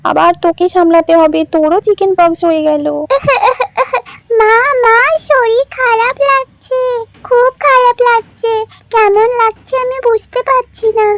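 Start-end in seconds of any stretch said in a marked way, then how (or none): 2.9-4.0 put-on child laugh. 4.4-11.1 put-on a small sick girl whimpering to mum; sick little girl. Sick daughter tells her mother she feels bad, slow high child voice.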